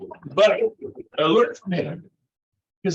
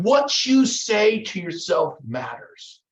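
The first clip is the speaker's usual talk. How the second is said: slow, raised, deliberate "What you say to yourself matters"; emphatic key point; 4 semitones higher, 4 dB louder, slower than usual. Real, not acted. Coach drives the lesson home to agents, slow and emphatic. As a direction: land each word of "What you say to yourself matters" slowly and firmly.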